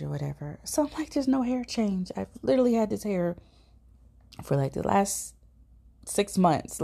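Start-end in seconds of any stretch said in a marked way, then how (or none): 1.1-6.8 agitated. Retold or imitated. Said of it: "being like a raging lunatic".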